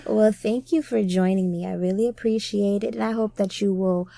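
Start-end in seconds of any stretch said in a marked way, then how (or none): none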